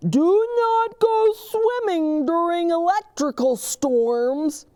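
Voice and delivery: high, loud proclaiming voice; proclaiming officer. Acted. Officer Buckle proclaims to the students, high and loud. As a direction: proclaim the tip to the hall.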